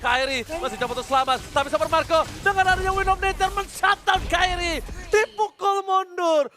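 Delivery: loud, high fight commentary; excited shouting. Real, not acted. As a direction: shout the teamfight as it happens. Hype late-game fight call. (teriakan komentator yang heboh)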